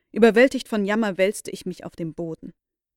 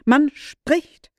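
excited; 7 semitones higher, 6 dB louder than usual. A narrator's reading. Repeats discovery more excitedly.

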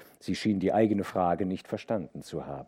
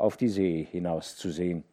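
usual speaking voice

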